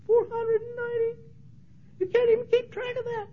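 high-pitched mock disbelief; high incredulous voice. Preacher mocking counting to four ninety, high disbelief. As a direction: squeak it out in disbelief.

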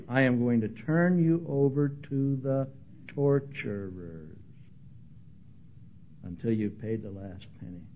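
slow, hushed, ominous sentence; solemn warning. Retold or imitated. The master sentencing the servant, slow and hushed. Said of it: "hear these words".

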